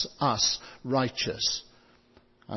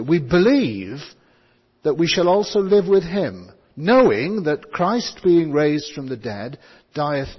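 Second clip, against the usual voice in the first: BELIEVE and KNOWING hit louder; stress on the verbs; 6 dB louder than usual. Shown as a demonstration. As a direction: punch "believe" and "knowing" as you read the verse. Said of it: "put some stress on these verbs".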